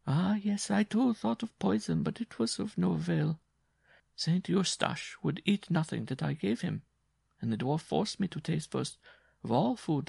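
a worn-out woman telling her hardship; Jean's voice. Acted.

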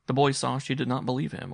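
ordinary delivery, nothing marked

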